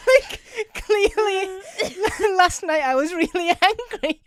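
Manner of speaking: high, loud words broken by laughter; laughing through words. Real, not acted. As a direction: fight through the giggles to get it out. Laughing at her own note.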